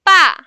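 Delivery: "ba" falling steeply from high; short, loud falling "ba"; fourth tone. Shown as a demonstration. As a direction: drop "ba" hard from top to bottom. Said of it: "And the fourth one".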